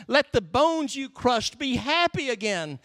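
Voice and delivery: high, imploring cry to God; begging. Acted. David asking God for joy, begging. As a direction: plead it, pitched well up. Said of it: "He's begging".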